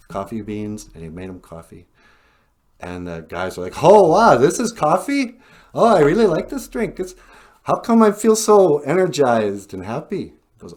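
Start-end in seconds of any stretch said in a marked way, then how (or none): 3.8-10.2 high, loud, thrilled exclaiming; acted-out giddy excitement; delighted amazement. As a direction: gush over a wonderful new discovery. Acted first taste of coffee.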